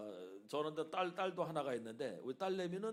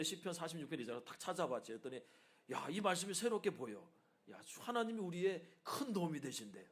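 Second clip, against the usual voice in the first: ordinary talk, nothing marked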